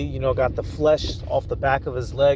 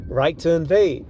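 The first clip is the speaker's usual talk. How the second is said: normal talking voice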